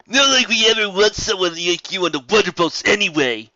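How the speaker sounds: high, loud sneering voice; snarling Wonderbolt. Acted. Wonderbolt snarling at Rainbow Dash, loud sneer. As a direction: snarl it out with contempt. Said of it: "Sword snarled".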